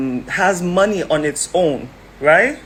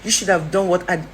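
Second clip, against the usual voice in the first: ordinary talk, nothing marked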